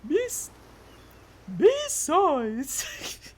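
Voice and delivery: high put-on female voice; high-pitched, experimental voice; attempted female character voice. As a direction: try out a high, drawn-out character voice. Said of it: "How the fuck do I do a voice?".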